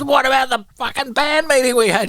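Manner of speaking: high furious shouting; angry yell. Retold or imitated. Chrissy yelling at guitarist.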